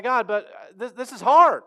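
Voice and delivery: loud, high anguished protest; pleading. Retold or imitated. Speaker protesting to God, loud and high. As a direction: push back at God, straining.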